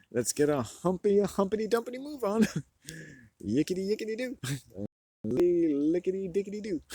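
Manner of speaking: high, laughing nonsense rhyme; giggly nonsense singsong. Pretend. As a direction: sing the silly words through giggles.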